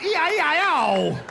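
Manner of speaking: high, loud mock scream; shouted punchline. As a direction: belt out the farm-song refrain as a yell. Shouted punchline of farm joke.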